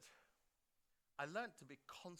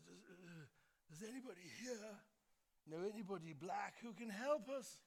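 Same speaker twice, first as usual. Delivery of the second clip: higher, breathy-free imitation of a prime minister, far from his own; 8 semitones higher than usual. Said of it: "I'm gonna use her voice".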